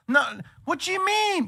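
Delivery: very high mock indignation; indignant partner. Partner objecting to skipping the party, very high mock voice. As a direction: squeak out the objection.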